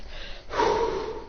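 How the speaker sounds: soft breath blown as flames; voiceless whoosh of fire; imitated burning whoosh. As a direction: blow out a soft fire effect.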